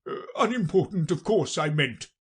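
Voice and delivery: king covering a slip; hasty king. Acted. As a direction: correct yourself hastily as a king. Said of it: "the king hastily said".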